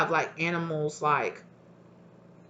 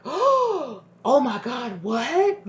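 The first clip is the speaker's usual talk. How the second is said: much higher, slow, drawn-out alarm; shocked; 7 semitones higher, 4 dB louder, much slower than usual. Retold or imitated. Listener reacting to a ghostly voice, high and alarmed. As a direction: drag out the shock, then jump up on what. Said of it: "And you're like".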